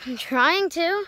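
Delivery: loud, high retort; calling back. Real, not acted. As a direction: call back, a bit irritated.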